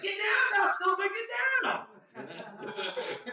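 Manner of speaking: high, slow yell at the screen; theater audience shout. Retold or imitated. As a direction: holler at the movie like a rowdy crowd. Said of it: "someone's like".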